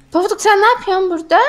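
gasp, then a high, fast startled question; surprised gasp. Acted. Mother finds Pofuduk hiding upstairs, gasping in surprise.

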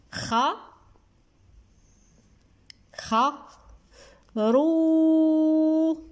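خروف in isolated syllables with long silences; sounding out while writing. Shown as a demonstration. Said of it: "نكتبوه باش هكذا".

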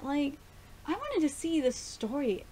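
ordinary talk

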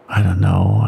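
low and dark, a bad omen; ominous mutter. A narrator's reading. Bill to Henry about the hungry wolves, muttered low. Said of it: "Bill muttered ominously".

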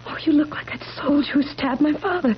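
whispered accusation from a haunted mind; frightened whisper. Acted. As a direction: breathe it out, seeing ghosts of the past. Deranged wife accusing her husband.

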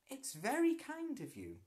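put-on grateful girl.

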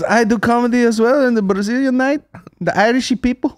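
high-pitched Brazilian broken English; imitated Brazilian accent. Brazilian comic chatting to Irishmen, imitated high Brazilian accent. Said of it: "She said it in very broken English".

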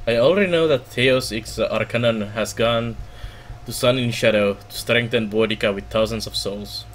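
ordinary talk

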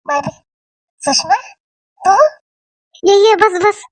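high, bright burst of welcome; excited welcome. Acted. To visiting Sushma, an eager excited welcome. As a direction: greet the friend eagerly.

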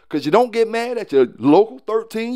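usual speaking voice

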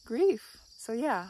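normal talking voice

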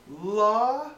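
high, loud, drawn-out question; mock clueless offer. Gunner offers the wrong weapon to his superior, mock clueless. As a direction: mimic a hapless gunner offering it up.

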